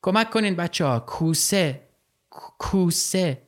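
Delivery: high, frightened call, stammering on کوسه; panicked cry for help. Acted. Nasro in the water calling the kids for help, panicked. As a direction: yell for help, stumbling over the word. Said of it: "فریاد کشید".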